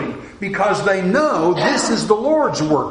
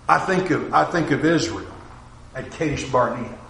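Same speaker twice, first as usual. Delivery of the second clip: ordinary delivery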